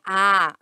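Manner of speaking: a said loud and wide open; a as the open stressed vowel of vatapá. Shown as a demonstration. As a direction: open the mouth on a long a. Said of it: "aberto, vatapá".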